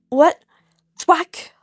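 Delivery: ordinary delivery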